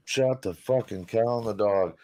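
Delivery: normal talking voice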